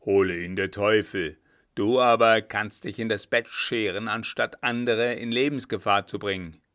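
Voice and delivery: loud, brusque cursing; gruff. Acted. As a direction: dismiss him harshly and forcefully. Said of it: "sprechen Sie nicht so barsch". (barsch und laut)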